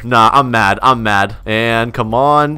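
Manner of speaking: ordinary talk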